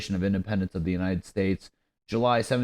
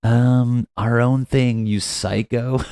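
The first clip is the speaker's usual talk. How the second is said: loud, higher start, dismissive mock retort; snotty retort; 4 semitones higher, 6 dB louder, slower than usual. United States texting back rudely to Britain, snotty retort. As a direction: brush them off with a snotty, drawn "um". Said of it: "We're just like".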